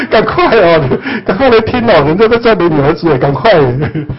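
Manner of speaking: giggling while summoning mom and dad; laughing call upward. Pretend. Son laughing as he calls his parents in heaven to listen.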